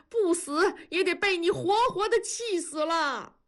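high, wailing reproach from a grieving neighbor; crying and shouting. A narrator's reading. Neighbor wails at Gouzi in tears, high crying shout. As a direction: cry out the scolding, voice pitched high. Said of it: "大壮开始哭喊".

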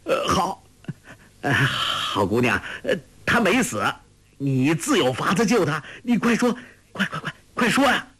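high, flustered, stammering urgency; frantic, high-pitched hurrying at the end; urgent pleading. A narrator's reading. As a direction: plead in a rising rush. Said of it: "急道".

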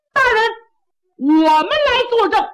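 high, loud call of 大人 from the back; shout from the crowd. Acted. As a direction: call out over the crowd to the judge. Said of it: "有人高喊".

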